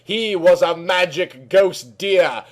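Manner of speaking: plain reading voice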